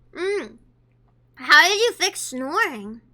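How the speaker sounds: ordinary delivery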